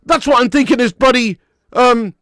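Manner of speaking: loud, high, exasperated rant. Real, not acted. Fan ranting to viewers about the striker's level, loud and exasperated.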